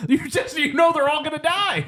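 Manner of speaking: high-pitched pleading objection, hammed up; exasperated mock protest. Arguing back at Montgomery, high mock exasperation. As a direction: push the voice way up in mock disbelief. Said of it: "And you're like".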